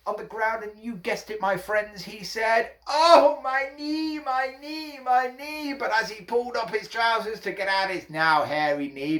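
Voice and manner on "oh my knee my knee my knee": high cry, loud then fading; hammed-up wail.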